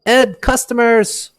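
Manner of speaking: loud, high call to the back room; slow, drawn-out shout toward the back room; shopkeeper yells. Acted. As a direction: bellow for his brother in back. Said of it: "and he yells".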